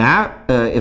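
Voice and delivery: ordinary talk, nothing marked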